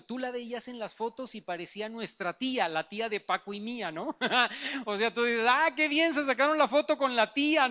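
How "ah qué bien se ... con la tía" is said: high, loud mock-cheerful remark. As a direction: tease like a fooled onlooker, bright and loud. Burla de las fotos. (comentario burlón, alto y fuerte)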